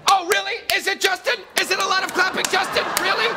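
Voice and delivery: high, loud mocking rant; angry yelling. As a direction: yell it sarcastically at him. Mocking confrontation with Justin.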